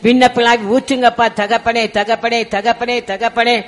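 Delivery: loud pleading prayer, pressing on and on; fervent prayer. Acted. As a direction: cry out to God with urgency, then settle down. Fervent intercessory prayer leading.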